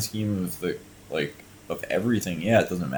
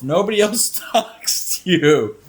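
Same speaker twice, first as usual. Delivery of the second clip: laughing; 10 semitones higher, 5 dB louder, slower than usual. Real, not acted. Laughs while mocking.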